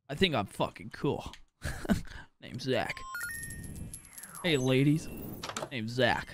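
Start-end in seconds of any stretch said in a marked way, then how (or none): 2.4-5.1 mock cool guy. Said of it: "Fucking Zach". 5.7-6.3 mock cool guy. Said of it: "Fucking Zach".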